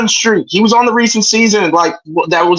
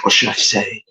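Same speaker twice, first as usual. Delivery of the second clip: villain voice; 12 semitones lower, 5 dB quieter than usual. Pretend. Villain voice, whispered punchline.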